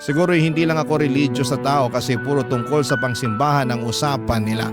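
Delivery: usual speaking voice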